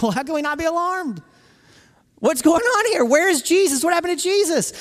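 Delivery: high, loud, frantic questions; acted alarm. Acts out the alarmed women at tomb.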